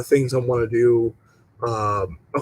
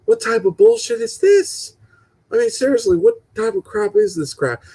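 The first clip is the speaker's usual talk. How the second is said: mock outrage; 8 semitones higher than usual. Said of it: "and I'm like".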